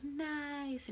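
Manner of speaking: high, soft baby-talk imitation of the word Nice; imitating baby's nice. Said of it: "his favorite thing to do is he goes".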